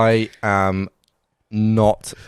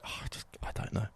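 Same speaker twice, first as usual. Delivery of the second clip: hesitant whisper; 19 dB quieter, faster than usual. Retold or imitated. Imitating own hesitant reaction.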